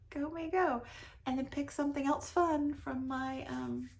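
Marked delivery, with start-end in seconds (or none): 0.1-0.8 self-cheer. Real, not acted. Cheering herself on.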